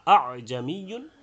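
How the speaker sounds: আ জামিয়ুন with two hard hamzas, slow and loud; a full glottal stop on the second hamza of জামিয়ুন; both hamzas hard. Shown as a demonstration. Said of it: "তাহকিক করলে দুইটা হতো".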